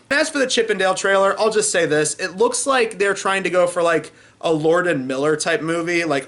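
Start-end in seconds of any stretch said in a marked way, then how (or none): none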